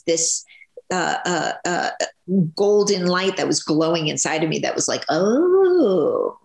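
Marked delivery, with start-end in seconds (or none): 5.1-6.4 long, rising-and-falling awed oh; acted realization. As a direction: let the oh swell high, then fall. Voices a sudden inner realization.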